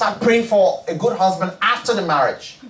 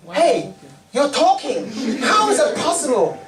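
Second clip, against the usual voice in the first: higher, laughing amazement; mock amazement; 6 semitones higher than usual. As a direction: gasp at a talking donkey, laughing. Amazed at talking donkey.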